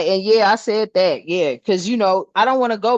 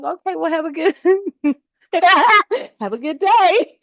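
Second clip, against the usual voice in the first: mock polite; 9 semitones higher than usual. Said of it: "I'd be like".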